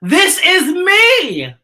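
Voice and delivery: high, loud, drawn-out proud cry; yelled proudly. Shown as a demonstration. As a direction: shout it out slowly like a proud declaration. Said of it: "stand up with your shadow and yell".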